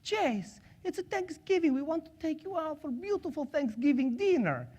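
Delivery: fond high Russian accent impression. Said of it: "she has this beautiful accent".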